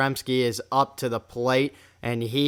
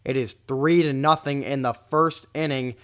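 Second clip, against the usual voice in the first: ordinary talk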